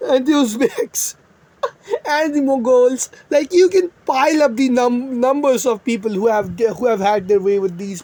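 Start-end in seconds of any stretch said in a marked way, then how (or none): 0.1-3.1 laughing. Real, not acted. Laughing while listing invaders.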